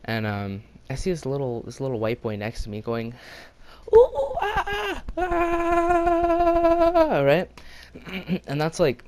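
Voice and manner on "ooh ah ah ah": very high, loud mock monkey calls; taunting ape hoots. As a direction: imitate a kid hooting at apes. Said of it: "he's, like, taunting them".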